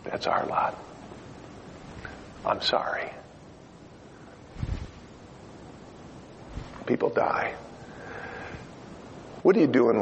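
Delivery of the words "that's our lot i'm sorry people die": hushed somber whisper. Real, not acted. Preacher telling the congregation people die, whispered. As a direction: whisper it gravely.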